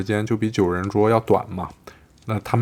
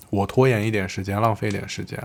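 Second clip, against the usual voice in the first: ordinary delivery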